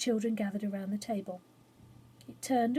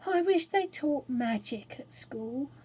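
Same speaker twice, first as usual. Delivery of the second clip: ordinary delivery, nothing marked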